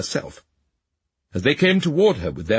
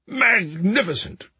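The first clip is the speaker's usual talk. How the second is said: delighted cry; 7 semitones higher, slower than usual. A narrator's reading. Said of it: "cried the king".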